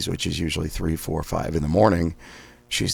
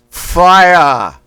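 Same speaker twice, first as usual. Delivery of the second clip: Boston accent; 10 semitones higher, 12 dB louder than usual. Shown as a demonstration. Coach demonstrating Boston vowel drill, loud thrust-out Boston Fire. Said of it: "We say fire like a Boston accent".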